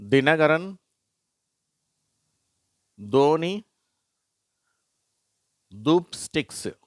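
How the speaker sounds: teacher modelling th names; careful name reading. Shown as a demonstration. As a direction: spell out each name for pupils to repeat. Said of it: "அதை நான் வாசிக்கிறேன்".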